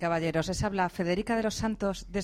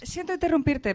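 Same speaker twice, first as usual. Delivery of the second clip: interrupción urgente; 9 semitones higher, 4 dB louder than usual. Acted. Host cuts in with urgent news.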